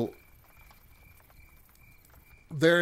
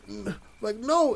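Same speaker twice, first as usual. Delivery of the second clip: high, louder yelp of refusal; retold cry of no; 10 semitones higher, 3 dB louder than usual. Pizza guy left behind while others flee, desperate cry. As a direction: voice leaps up on a desperate no.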